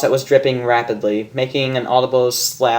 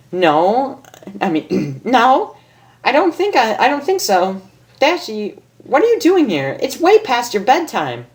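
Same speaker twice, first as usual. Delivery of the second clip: much higher bubbly pony voice; Pinkie Pie voice; 10 semitones higher than usual. Acted. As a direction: chirp it like a hyper cartoon pony.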